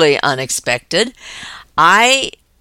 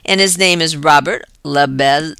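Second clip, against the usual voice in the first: ordinary talk, nothing marked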